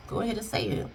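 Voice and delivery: very soft, nonchalant prompting; emotionless. Retold or imitated. Husband with no emotion urging wife on, nonchalant. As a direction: murmur it as if you feel nothing. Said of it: "no emotion in your eyes".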